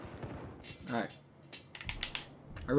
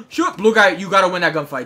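loud, high outburst; exasperated yell; 5 semitones higher, 11 dB louder than usual. Real, not acted. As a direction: shout at a teammate who lost the fight. Yells at teammate mid-fight.

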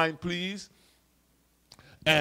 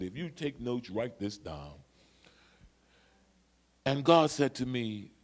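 normal talking voice

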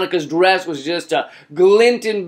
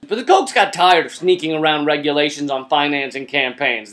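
normal talking voice

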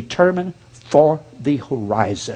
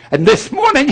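higher pulpit intonation imitated; Adrian Rogers; 11 semitones higher than usual. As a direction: lightly mimic a famous preacher's opener. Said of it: "Adrian Rogers talks".

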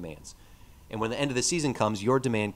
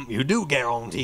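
put-on Cajun accent; 2 semitones higher, 5 dB louder than usual. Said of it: "I just imitated the a-, assistant coach".

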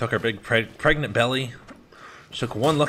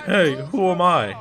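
drawn-out, higher, mildly mock call; friend's playful riddle; 6 semitones higher, 4 dB louder, slower than usual. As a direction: imitate a kid calling out a guessing game. Imitates friend's drunk guessing game.

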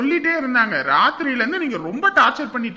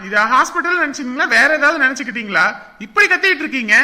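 raised-voice telling-off; loud scolding; 5 dB louder than usual. Acted. Doctor telling a screaming patient to stop, scolding loudly. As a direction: shout over the patient's wailing.

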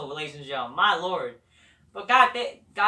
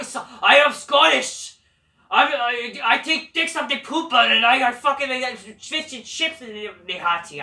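mock Scottish accent; 2 semitones higher, 4 dB louder than usual. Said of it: "he's a Scottish guy".

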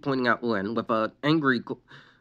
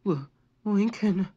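sorrowful whisper; 9 semitones higher, slower than usual. A narrator's reading. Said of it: "she whispered sorrowfully".

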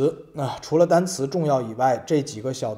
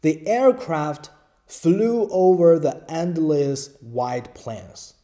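slow model reading; 2 semitones higher, 4 dB louder, much slower than usual. Shown as a demonstration. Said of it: "原文有一句话".

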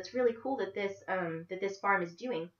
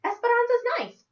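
put-on much higher, louder child voice; a young girl blurting it out, puzzled; confused little girl; 9 dB louder than usual. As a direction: Isabel defending Esperanza, confused and high. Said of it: "Confused, Isabella added".

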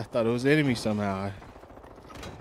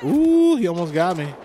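startled; 6 semitones higher, 8 dB louder than usual. Real, not acted. Near miss in a firefight.

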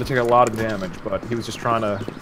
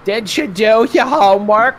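mom voice; 9 semitones higher, 3 dB louder than usual. Pretend. Mock mom voice in game.

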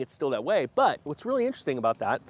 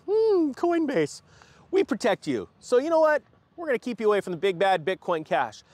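mock Coinbase; 5 semitones higher than usual. Coinbase keeping customers from Bitcoin Cash, mock protective. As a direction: play a falsely caring company. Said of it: "is that Coinbase decided".

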